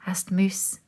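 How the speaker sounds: usual speaking voice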